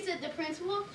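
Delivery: ordinary delivery, nothing marked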